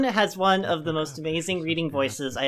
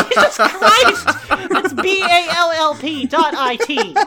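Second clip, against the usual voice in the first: loud, slow laughing exasperation; 8 semitones higher, 6 dB louder, slower than usual. Real, not acted. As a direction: laugh while correcting.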